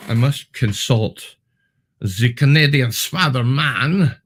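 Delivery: a goofy put-on Steve Martin accent, savouring each word; old Steve Martin accent. Host to chat, announcing in a hammed-up Steve Martin accent. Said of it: "it's an old Steve Martin accent".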